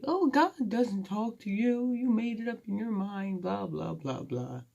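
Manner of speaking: mock the doubters in a lofty sneer; mocking critics. Mocking doubters who say God doesn't talk to her, high sneer. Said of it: "They could be like".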